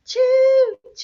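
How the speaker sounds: high, loud choo-choo whistle; train whistle sound. Shown as a demonstration. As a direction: toot it like a toy train. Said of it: "Can you make that sound with me".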